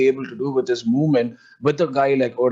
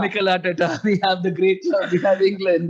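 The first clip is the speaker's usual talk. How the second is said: Twitter fan impression; 5 semitones higher than usual. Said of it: "I'm gonna talk like that".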